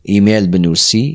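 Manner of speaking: normal talking voice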